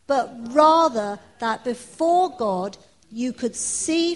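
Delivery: loud, slow, solemn Bible reading; scripture reading. Acted. Preacher reading Paul's letter aloud to the congregation, loud and slow. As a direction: proclaim the letter to the church, slowly.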